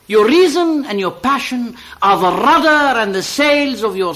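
rising oratorical recital; scripture-like reading. Acted. Reader recites the prophet's answer to the priestess, oratorical. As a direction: declaim it like a prophet. Reads The Prophet passage aloud.

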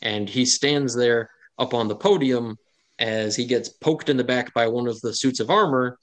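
normal talking voice

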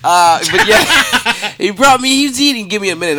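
high, loud laugh through the words; can't stop laughing as he talks; laughing while talking. Real, not acted. Comic laughing through his story, high and loud.

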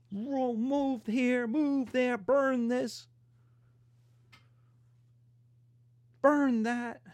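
high, drawn-out put-on voice; mock invaders. Lecturer voicing Swedes invading Germany, high mock invader voice. Acting out invading army's rampage.

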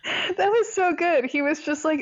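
usual speaking voice

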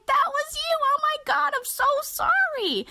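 very high squealed apology; top-of-the-voice squeal, gushing sorry; mortified confession. Retold or imitated. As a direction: act out frantic, mortified excitement. Retold shrieked confession and apology.

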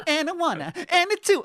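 very high imitated count-in; conductor voice. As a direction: switch to a dainty conductor's count. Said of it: "to, like".

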